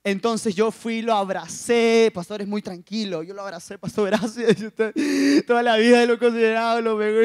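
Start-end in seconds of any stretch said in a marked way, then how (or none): 3.8-7.2 loud, overcome mock blubbering; mock tearful gratitude. Young man hugging his pastor in thanks, hammed-up tearful voice. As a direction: blurt thanks as if crying, hammed up. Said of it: "estaba llorando".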